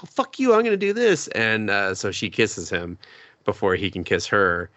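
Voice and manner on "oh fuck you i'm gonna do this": hammed-up defiant girl, very high; defiant heroine. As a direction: fire back to beat him. Heroine defiant, kisses first.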